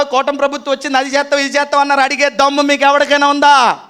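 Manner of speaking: fiery preacher pounding the point home; forceful preaching. Acted. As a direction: press the crowd with each question.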